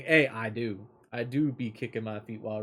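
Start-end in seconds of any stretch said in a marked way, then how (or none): none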